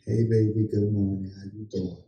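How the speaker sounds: low, soft pillow-talk imitation; mock sweet greeting. Husband imagining a tender good morning, mock sweet and low. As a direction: croon a morning hello, deep and hushed.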